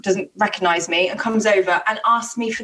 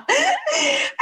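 a sudden high-pitched laugh bursting out; high burst of laughter. Real, not acted. Laughing high at the co-host's blowjob question. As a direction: laugh out high and helpless at the joke.

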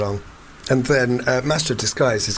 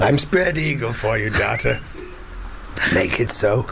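mock seductive captain; 3 semitones lower than usual.

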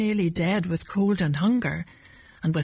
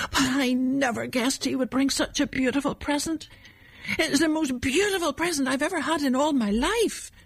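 put-on awed child; 7 semitones higher than usual. A narrator's reading. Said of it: "he whispered".

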